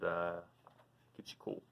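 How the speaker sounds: plain reading voice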